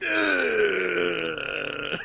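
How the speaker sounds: long moan sliding downward; mock groan slowly winding down; vegetative groan. Friend slowly losing brain cells, mock draining groan. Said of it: "just making noises in the accent".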